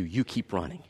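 quietly encourage, close to the ear; tender reassurance. Acted. God comforting a hurting runner, soft and tender.

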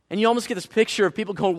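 ordinary talk

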